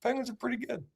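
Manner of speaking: high, soft mock Canadian accent, grudging. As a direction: sigh it out like a reluctant Canadian fan. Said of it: "Canada's just begrudgingly realizing".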